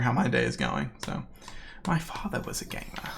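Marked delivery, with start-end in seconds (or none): 1.9-3.1 breathy whispered quote; whispered Joker line. Shown as a demonstration. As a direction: hush it like a secret. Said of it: "what the Joker quote is".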